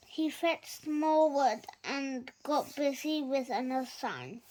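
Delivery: ordinary delivery, nothing marked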